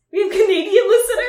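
high, loud, quick disbelieving question; amused surprise. Real, not acted. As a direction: burst out laughing in surprise. Surprised at news of listeners.

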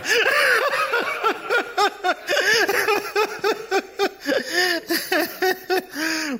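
long, very high-pitched peals of laughter; laughing fit. Real, not acted. Preacher overcome by his own joke to congregation, helpless laughing fit.

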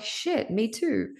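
drawn out slowly, high and knowing; slow relieved agreement. Retold or imitated. Followers resonating with her post, slow knowing swear. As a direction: let it sink in slowly.

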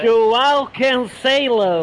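very slow, drawn-out mock-English name; exaggerated English accent. Reading a player's name from UEFA's guide, exaggerated English accent. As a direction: stretch every vowel like a hammy English speaker. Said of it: "hanno messo la pronuncia".

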